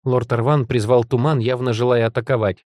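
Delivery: ordinary delivery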